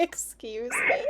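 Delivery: slow, high, laughing excuse me; laughing disbelief. Real, not acted. Reacting to a friend's bird theory, laughing slowly. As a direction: drag it out through a laugh.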